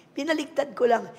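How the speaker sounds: ordinary delivery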